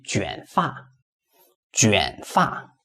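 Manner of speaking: teacher modelling third tones on "卷发"; two third tones. Shown as a demonstration. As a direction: dip each syllable of "卷发" separately. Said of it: "this one will be third tone".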